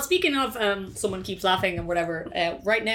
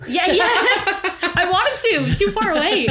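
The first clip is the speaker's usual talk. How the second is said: high, loud laughing talk; words squeezed out through laughter; 12 semitones higher, 8 dB louder than usual. Real, not acted. Laughing hard.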